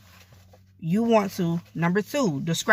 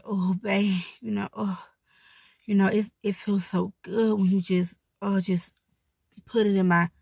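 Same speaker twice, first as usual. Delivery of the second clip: moaning while talking. Shown as a demonstration. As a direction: let the lines trail into moans. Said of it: "don't be afraid to moan".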